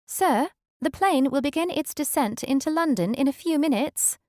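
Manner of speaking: put-on high, polished English accent; posh British accent. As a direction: announce it like a posh British flight attendant. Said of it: "the flight attendant said in a posh accent".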